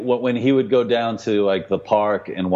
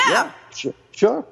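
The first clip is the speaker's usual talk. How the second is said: much higher, airy mock coach voice. Podcaster mimicking the coach, high and mocking. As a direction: squeak it like a clueless coach. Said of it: "the coach was like".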